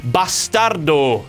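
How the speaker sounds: slow lightly foreign "Bastardo"; mock foreign pronunciation; foreign accent. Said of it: "as some other language would say".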